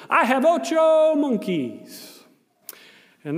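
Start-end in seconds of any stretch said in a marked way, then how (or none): 0.1-2.2 very slow and high; slow, exaggerated. Volunteer announcing his monkey count, slow and exaggerated. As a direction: stretch it out for the child.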